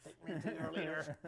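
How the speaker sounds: soft far-off alien demand; quietly alien, half laughing; booming arrogant alien overlord. Retold or imitated. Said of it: "Beavis and Butthead Do America".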